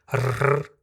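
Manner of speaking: "rr" trilled, repeated on the palate; rolled R. Shown as a demonstration. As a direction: roll "rr" as a long trill. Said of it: "com esse R". ("rr" vibrante múltipla)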